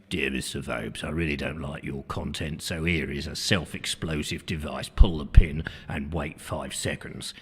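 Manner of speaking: deep, low sinister voice reading a threat; menacing letter writer. Retold or imitated. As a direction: growl it like a mock villain. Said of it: "someone's going".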